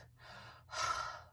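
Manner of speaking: a long unvoiced exasperated breath out; imitated breath. Imitating the ghostly breath heard in the haunted hallway, exasperated exhale. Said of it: "we heard a breath".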